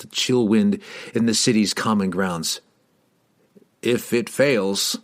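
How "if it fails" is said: slow, grave start. A narrator's reading. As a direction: begin slowly and heavily. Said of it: "he began slowly".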